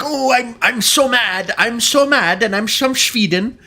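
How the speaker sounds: lightly hammed Swedish accent complaining; mock angry Swede. To chat, imitating an angry Swede in a mock Swedish accent. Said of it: "when a Scandinavian person gets mad".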